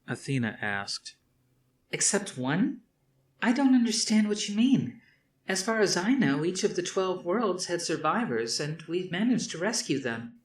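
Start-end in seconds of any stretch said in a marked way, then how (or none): 1.9-10.4 put-on higher, puzzled young woman; young woman's voice. A narrator's reading. Athena questioning her father about the lost colony, higher and puzzled. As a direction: push back, honestly confused.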